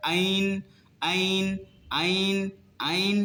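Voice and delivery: আইন short, with no throat pressure, like a hamza; hamza-like ayn. Shown as a demonstration. Teacher showing students the mistake, আইন said like hamza. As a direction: say আইন lightly from a plain glottal onset, unsqueezed. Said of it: "এটা হামজার মতন উচ্চারণ হয়ে যাবে".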